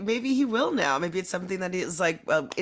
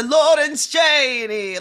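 loud, high, drawn-out name; imitated name call; 7 semitones higher, 7 dB louder, much slower than usual. Guest showing how they always say that name, loud showy call. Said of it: "they were always saying that name".

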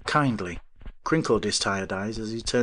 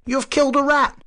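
high, loud and accusing; outraged protest; 7 dB louder than usual. A narrator's reading. Avery accusing the professor, loud and high. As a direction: hurl the charge at him. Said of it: "he protested".

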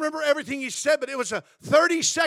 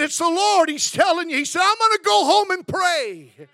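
fervent loud testimony; 6 semitones higher, 8 dB louder than usual. Retold or imitated. Man tells son it's God.

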